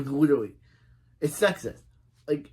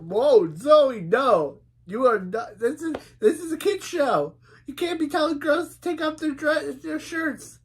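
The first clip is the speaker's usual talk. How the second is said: shocked outcry; 11 semitones higher, 4 dB louder than usual. Real, not acted. Shocked at kid's show line.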